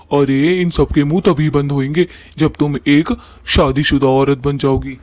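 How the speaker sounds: ordinary talk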